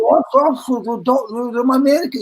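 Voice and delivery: high, stammered mock protest; mock panic. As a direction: imitate a flustered explanation to police. Retold flustered explanation to police.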